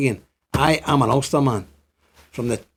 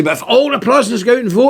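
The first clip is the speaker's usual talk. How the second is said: raised, hopeful voice of the objectors; imitating objectors; 9 semitones higher than usual. To viewers, mimicking those who say voting wins, higher pitched. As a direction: voice the naysayers' hope, a bit higher. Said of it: "And people say".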